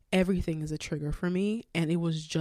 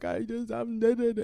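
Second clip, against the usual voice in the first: retold crying babble; 5 semitones higher than usual. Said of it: "I'm crying, I'm like".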